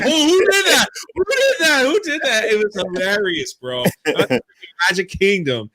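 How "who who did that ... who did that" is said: high, shrill-pitched panicked cries; panicked crowd. Retold or imitated. Parkgoers hearing a cough, panicked high cries. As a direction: squeal in alarm like a frightened crowd. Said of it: "Everyone's like".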